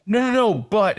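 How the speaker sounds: high, quick protesting interjection; hurried protest. Retold or imitated. Client objecting to doctor in therapy, protesting. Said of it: "And I'm like".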